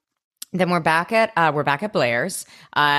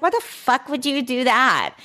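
high mock bratty voice; a put-on little J, high and incredulous; 8 semitones higher than usual. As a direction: pitch up as the bratty little sister.

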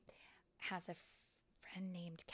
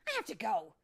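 flustered exit line; 3 semitones higher, 13 dB louder than usual. Acted. Tink flees after outburst.

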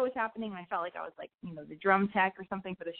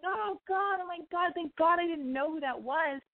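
acted relief; 10 semitones higher than usual. Acts out relief at stranger intruder.

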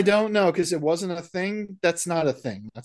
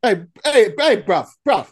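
mock British; 4 semitones higher, 7 dB louder than usual. Mock British banter, louder.

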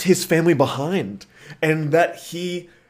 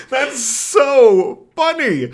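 high, drawn-out, louder cry of amusement; tickled delight; 11 semitones higher, 5 dB louder, much slower than usual. Real, not acted. Co-host cracking up at a retold cartoon joke, high delight. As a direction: stretch SO and FUNNY up high in helpless delight.

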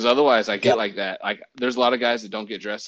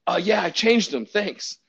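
higher, carefree thanks; breezy reply; 7 semitones higher, 4 dB louder than usual. Retold or imitated. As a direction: brush it off cheerfully. Oblivious reply to partner.

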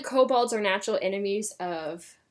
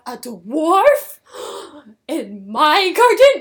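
loud, shocked witch with a gasp; hammed-up indignant gasp, louder; outraged witch; 3 semitones higher, 9 dB louder, much slower than usual. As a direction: clutch your pearls at the dwarf. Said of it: "she's like".

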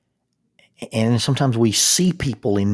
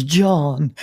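high, loud answering call; hammed-up romantic shout; melodrama yell; 6 semitones higher, 6 dB louder than usual. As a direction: shout his name back across the field. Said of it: "and she yells".

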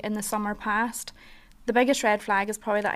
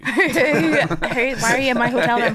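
laughing retelling; 2 semitones higher, 6 dB louder, slower than usual. Real, not acted. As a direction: laugh as you act out the shock. Retelling a stranger in her room, laughing.

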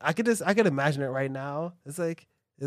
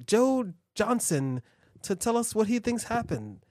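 news anchor; 4 semitones higher than usual. Pretend. Mock news report.

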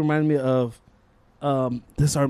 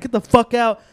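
retold angry order, higher and louder; raised-voice mock ejection; pissed-off teacher; 7 semitones higher, 5 dB louder than usual. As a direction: throw the student out as an angry teacher. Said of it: "super pissed".

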